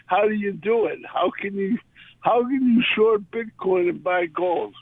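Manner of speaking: usual speaking voice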